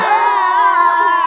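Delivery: high group yell; holler. Acted. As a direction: everyone hollers along with the scream. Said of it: "Everyone holler".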